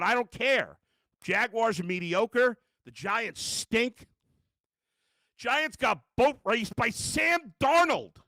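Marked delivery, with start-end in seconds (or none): 5.4-8.2 loud, high, drawn-out disbelief; outraged shout. Real, not acted. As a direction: shout it slowly, hammering every word. Ranting about a humiliating team loss.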